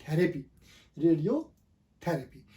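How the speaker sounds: रेडियोथेरेपी said slowly, stressing थेरेपी with a clear e; slow teacher model of थेरेपी; correct pronunciation. Shown as a demonstration. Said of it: "ये actually word है". (थेरेपी को धीरे और साफ़ बोलें)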